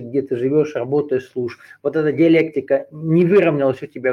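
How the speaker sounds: ordinary talk, nothing marked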